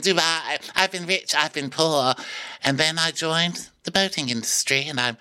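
a high, dreamy retelling of a sad backstory; hammed-up high voice, full of drama; high dramatic mock voice. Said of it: "she's like".

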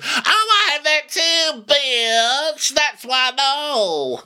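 very high, drawn-out caricature; imitated boastful woman. Imitating a woman bragging about Baccarat, shrill caricature. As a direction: squeal it slowly in a put-on high voice.

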